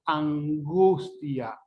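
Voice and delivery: slowly stretched "angustia" with a velar ng; lingering back n before the g in "angustia"; velarized n. Shown as a demonstration. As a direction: draw out "angustia", tongue back off the tooth ridge. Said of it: "La lengua no llega a tocar en los alveolos".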